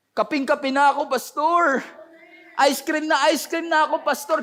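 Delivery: high-pitched pleading complaint; hammed-up, high begging; mock whining. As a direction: whine to the pastor like a craving member. Acting out craving church members.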